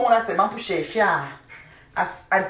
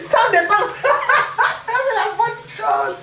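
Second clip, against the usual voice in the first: high-pitched giggles through the words; laughing; 4 dB louder than usual. Real, not acted. Reading a viewer's comment, laughing hard. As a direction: laugh helplessly between words.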